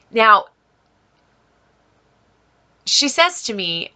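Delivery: ordinary talk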